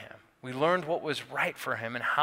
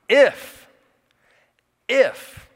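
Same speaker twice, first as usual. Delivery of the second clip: If. If. each shouted high and loud alone; If. If. hammered out as the key word; stress on IF; 9 semitones higher, 10 dB louder than usual. Real, not acted. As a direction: punch If. If. as two ringing calls. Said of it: "I want you to circle that word".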